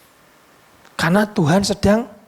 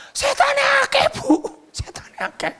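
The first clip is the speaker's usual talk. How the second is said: high pupil voice fading into a whisper; child's report. Retold or imitated. Pupil telling the teacher there are many demons, high then hushed. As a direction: tell it like a scared kid, dropping to a hush.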